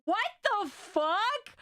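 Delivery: high, drawn-out disbelief; appalled disbelief. Real, not acted. Viewer aghast at the seagulls, appalled disbelief. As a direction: stretch it out, pitched high, aghast. Reacting to chicks being killed.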